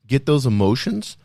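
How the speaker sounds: ordinary talk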